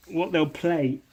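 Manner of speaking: ordinary delivery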